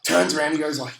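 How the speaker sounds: ordinary delivery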